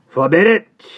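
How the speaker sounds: high, loud solemn declaration; Jerry preaching his vow to Miss Pross, loud. Acted. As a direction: proclaim it like a sermon. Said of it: "with additional solemnity".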